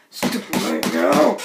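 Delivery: very low, slow angry croak; strained rage. Acted. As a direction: choke out the order. Raging at hackers.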